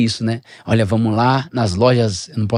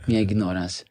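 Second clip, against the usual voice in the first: normal talking voice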